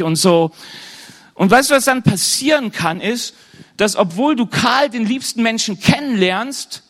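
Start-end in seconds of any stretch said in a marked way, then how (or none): none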